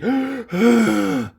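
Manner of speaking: loud sharp gasp; sharp shocked gasp. Acted. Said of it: "gasps Charisse".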